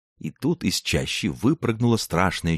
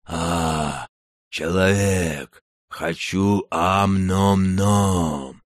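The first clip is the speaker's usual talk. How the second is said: slow, drawn-out monster growl; a dim giant growling with joy; happy growling giant; much slower than usual. Acted. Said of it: "Счастливо зарычало чудовище".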